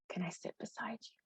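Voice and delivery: soft, careful whisper; cautious whisper. Retold or imitated. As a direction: ask gently in a whisper so as not to scare him. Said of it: "I approached with caution and I said".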